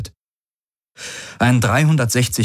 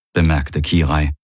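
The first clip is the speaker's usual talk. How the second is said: usual speaking voice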